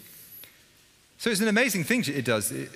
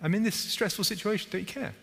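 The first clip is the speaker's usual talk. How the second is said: pleading prayer; 7 semitones higher than usual. Retold or imitated. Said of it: "calling out to Jesus".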